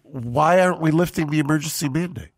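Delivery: ordinary talk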